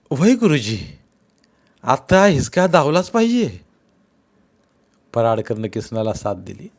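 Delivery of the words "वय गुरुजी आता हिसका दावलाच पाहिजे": angry agreement. Acted. Paradkar backs Kisan.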